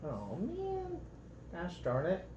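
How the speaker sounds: usual speaking voice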